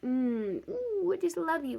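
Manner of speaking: gushing mock baby-love; cooing adoration. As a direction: coo as if to a baby. Adoring a baby, acted.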